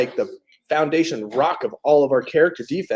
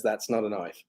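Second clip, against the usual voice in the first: quiet, lower attempt at an Aussie accent; imitated Australian accent; 5 semitones lower, 11 dB quieter than usual. Host quoting Crocodile Dundee to viewers, imitated Australian accent. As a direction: mimic an Australian, understated and low. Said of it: "how a real Australian says".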